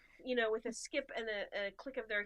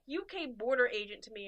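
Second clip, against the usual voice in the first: usual speaking voice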